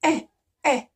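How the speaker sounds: "E" as a short "eh", not "ee"; vowel sound demo. Shown as a demonstration. Teacher isolating the vowel sound, "E" said as short "eh". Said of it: "it starts with the vowel sound".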